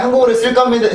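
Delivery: ordinary delivery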